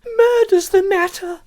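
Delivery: high, loud, slow panicked cry; frantic outburst. A narrator's reading. Frantic woman bursting out to the inspector, high panicked cry. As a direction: she blurts out the horror, wide-eyed. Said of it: "she burst out".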